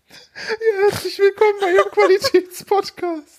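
high, teary laughing voice; laughing through tears. Real, not acted. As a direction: speak while crying with laughter.

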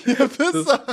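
high villain voice breaking into laughter; dark lord. Pretend. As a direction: finish the gloating insult, laughing. (hohe Bösewichtstimme, lachend)

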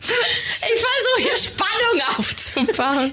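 high, slow, whiny complaint; comic crying protest; mock whine. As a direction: whimper it like a sulking child. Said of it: "Ist doch blöd betont".

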